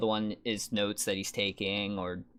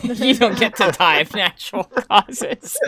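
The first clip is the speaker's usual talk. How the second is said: loud laugh through words; 12 semitones higher, 15 dB louder than usual. Real, not acted. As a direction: burst out laughing as you speak. Laughing at joke.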